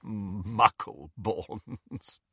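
very slow, drawn-out scornful word; drawling sneer; sniggering disdain. A narrator's reading. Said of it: "and sniggered".

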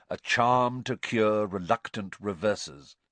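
normal talking voice

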